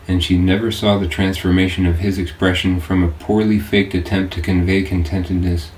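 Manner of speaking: ordinary delivery, nothing marked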